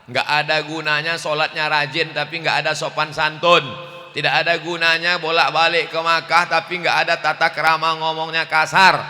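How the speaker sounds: loud, rising preacher's cry; loud sermon delivery; forceful preaching. Real, not acted. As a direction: preach it out to the crowd.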